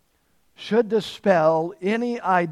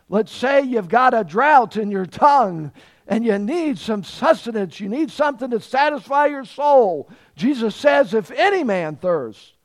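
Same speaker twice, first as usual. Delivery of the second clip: higher, louder, driving exhortation; full-force preaching; 5 semitones higher, 5 dB louder than usual. Real, not acted. Preacher urges the spiritually thirsty to come, preaching at full force. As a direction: press the appeal home with force.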